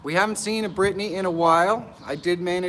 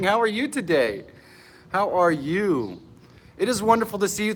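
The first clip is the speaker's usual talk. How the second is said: usual speaking voice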